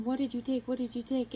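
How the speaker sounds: usual speaking voice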